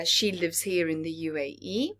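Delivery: ordinary delivery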